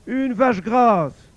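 high, loud, drawn-out offer; woman bargaining. Acted. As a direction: stretch out the biggest bid, high and loud. La femme surenchérit encore. (surenchère aiguë, forte et traînante)

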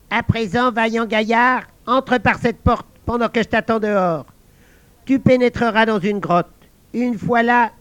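high, light talking frog; a small frog giving orders. Acted. Frog asking the guard to set her down, high voice.

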